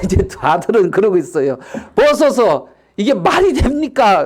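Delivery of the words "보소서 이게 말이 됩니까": high-pitched angry protest; worked-up, raised-pitch complaint; indignant son. Acted. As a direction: demand to know how this is fair. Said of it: "막 그러면서 이제 지금".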